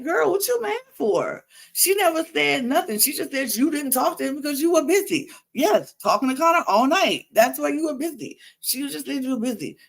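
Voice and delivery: high-pitched incredulous protest; high, exasperated recounting of a reaction; exasperated disbelief. Retold or imitated. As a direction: argue back as if she were here, voice up. Exasperated, recounts own reaction to Leah.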